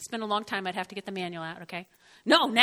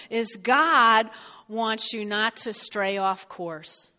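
ordinary delivery

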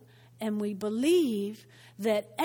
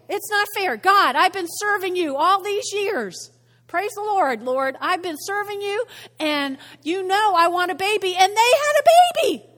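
high, loud pleading grievance climbing to a wail; hammed-up whine, peaking at the end; miffed complaint; 7 semitones higher, 8 dB louder than usual. Childless believer complains to the Lord, miffed and wailing. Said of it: "I'm miffed".

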